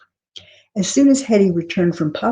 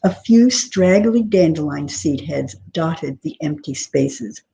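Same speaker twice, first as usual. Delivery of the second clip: plain reading voice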